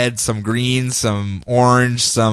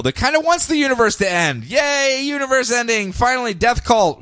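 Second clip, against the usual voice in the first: mock cheering cultist; 8 semitones higher than usual. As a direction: cheer like a gleeful doomsday fan. Mock cheering death cult.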